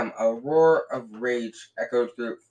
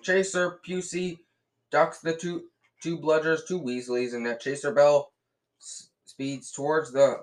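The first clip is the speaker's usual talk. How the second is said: higher, brisk play-by-play; commentator; 4 semitones higher than usual. A narrator's reading. Lee Jordan calling the play to the stands, lively commentary. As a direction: keep the match call rolling.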